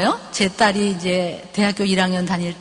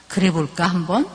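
gentle coaxing mother; 5 semitones lower than usual. Shown as a demonstration. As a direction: coax a sick child to open up. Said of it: "한번 이렇게 하는 거야".

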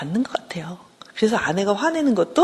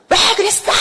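high-pitched mock outburst; overblown anger; 13 semitones higher, 4 dB louder than usual. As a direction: imitate a wife blowing up far out of proportion. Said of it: "한 사십을 화를 낸다는 거죠".

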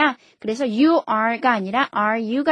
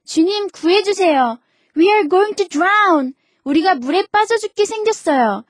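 high, louder frightened plea to be saved; panicked cry for help; 7 semitones higher, 4 dB louder, slower than usual. Acted. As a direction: cry out as if the boat is sinking. Disciples cry out in storm.

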